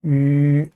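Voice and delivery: 위 held as a steady ü; unchanging vowel. Shown as a demonstration. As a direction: keep 위 rounded from start to finish. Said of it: "처음부터 끝까지".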